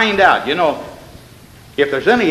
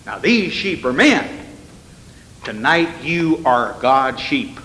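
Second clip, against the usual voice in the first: ordinary talk, nothing marked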